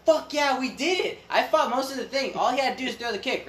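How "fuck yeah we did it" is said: excited cheer. Retold or imitated. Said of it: "I was like".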